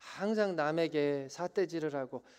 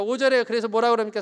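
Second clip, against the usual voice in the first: ordinary talk, nothing marked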